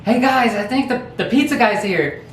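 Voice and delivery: calling out hey guys to an empty room; calling out to the house. Retold or imitated. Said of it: "he goes".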